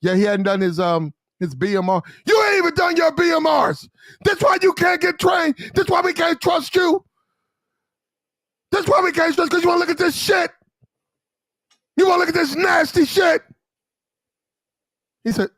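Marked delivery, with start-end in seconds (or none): 2.3-13.4 mock officer yelling. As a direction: bellow at the sailor like a furious lieutenant. Lieutenant shouting at sailor.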